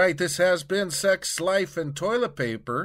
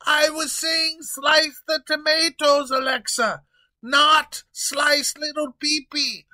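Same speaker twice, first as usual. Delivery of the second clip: loud, high protest with "not" punched; frantic yelling king; 9 semitones higher, 6 dB louder than usual. Acted. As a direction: correct the mistake in a panic. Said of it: "he continues yelling".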